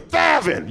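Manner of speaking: high "Thevin" with an exaggerated TH; lisped name. Lisping mother answers the doctor, high "Thevin" with strong TH. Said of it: "Your mom has a lisp".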